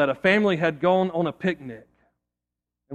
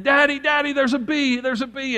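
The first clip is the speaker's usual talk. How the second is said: put-on high, frantic child calling out; urgent mock scream; screaming little girl; 8 semitones higher than usual. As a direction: imitate a panicked little girl, pitched well up. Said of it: "She's screaming".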